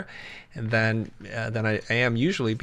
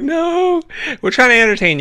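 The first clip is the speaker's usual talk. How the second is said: laughing; 13 semitones higher, 9 dB louder than usual. Retold or imitated. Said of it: "And they're like".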